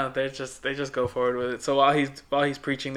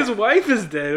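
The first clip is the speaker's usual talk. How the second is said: higher, louder burst of disbelief; mock indignation; 8 semitones higher, 7 dB louder than usual. Cohost objecting to the daughter's outburst, mock-indignant. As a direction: protest it loudly, pitch jumping up.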